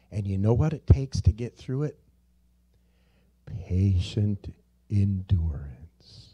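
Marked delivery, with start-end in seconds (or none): 3.7-6.3 "Patient endurance" barely voiced and drawn out; whispered. Shown as a demonstration. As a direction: breathe the answer slowly. Whispered answer.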